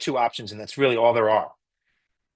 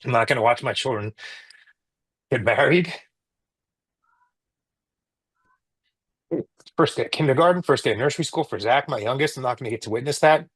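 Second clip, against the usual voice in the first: choking up. Real, not acted. Telling the interviewer about missing his kids, choked up. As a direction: voice catching with real emotion, holding back tears. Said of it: "I'm sorry, I'm getting so emotional".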